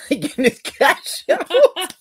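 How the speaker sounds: high, slow words through laughter; laughing. Real, not acted. Retelling a comedian's bit, laughing through the punchline. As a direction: crack up on the punchline.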